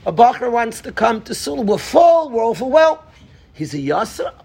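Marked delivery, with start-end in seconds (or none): 0.1-3.0 higher, brushing off the request; a put-upon "we're full"; mock overwhelmed refusal; busy camp director.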